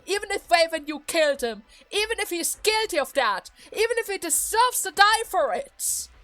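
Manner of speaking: high, forceful betrayed outburst; angry accusation. Acted. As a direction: pour out accusations in rising anger. Daughter accuses father of betrayal.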